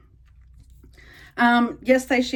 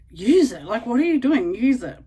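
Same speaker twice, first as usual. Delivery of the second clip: mock scolding; 4 semitones higher, 7 dB louder than usual. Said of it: "And I know she'd be like".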